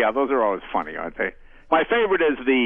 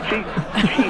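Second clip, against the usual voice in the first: usual speaking voice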